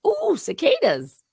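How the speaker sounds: high, slow fake wonder at cicadas; mock amazement. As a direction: coo it like a fake-impressed spectator. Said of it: "that's like saying".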